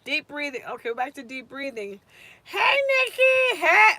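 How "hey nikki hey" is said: much higher, slow sing-song hey; excited greeting. Real, not acted. Teacher greeting a student who joined the live, high and delighted. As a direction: stretch the hello up high, delighted.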